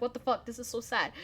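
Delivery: low, soft, mildly sad retold reaction; hushed, low note of dismay; saddened disbelief. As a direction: retell it quietly, a touch heartsick. Retelling a sad reaction to story.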